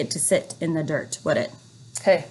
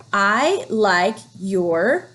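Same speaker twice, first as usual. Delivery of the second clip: Oh, I like your shirt read very slowly, fading at the end; teacher modelling slow reading; slow reading aloud; much slower than usual. Shown as a demonstration. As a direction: stretch it out slowly. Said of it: "modelling smooth reading".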